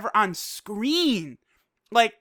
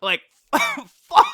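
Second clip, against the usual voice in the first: high, loud laughing curse; laughing disbelief; 13 semitones higher, 6 dB louder than usual. Real, not acted. Reviewer still reeling from the kill scene, high laughing curse.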